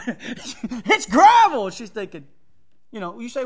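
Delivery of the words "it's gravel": much louder, very slow, a put-on voice; drawn-out mock reply. Man mocking a woman's gravel road answer, slow and loud. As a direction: stretch out gravel like a country drawl.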